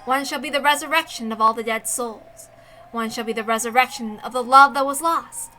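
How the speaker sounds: usual speaking voice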